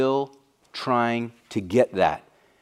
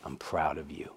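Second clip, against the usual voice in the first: much softer, barely voiced; whisper-soft last words to a son; quiet dying father; 9 semitones lower, 14 dB quieter than usual. Dying dad tells his son he's proud, very softly. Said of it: "One of the last things my dad ever said".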